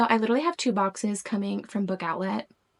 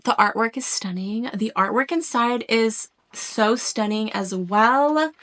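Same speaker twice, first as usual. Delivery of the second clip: ordinary delivery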